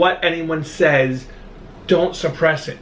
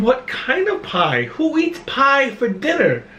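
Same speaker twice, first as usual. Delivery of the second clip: retold bafflement, higher and drawn out; mock incredulous; 8 semitones higher, slower than usual. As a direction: imitate a baffled listener, slow rising pitch. Baffled listeners quoted in retold story.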